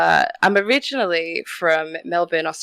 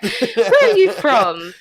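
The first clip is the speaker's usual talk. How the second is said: high, slow nosy question; mock curious stranger; 9 semitones higher, 4 dB louder, slower than usual. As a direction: ask where she's from like a curious stranger. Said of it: "they're just like".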